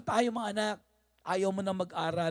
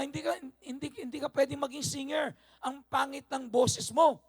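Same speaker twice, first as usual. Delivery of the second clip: scornful voice teacher; 8 semitones higher than usual. Retold or imitated. Voice teacher belittles boy.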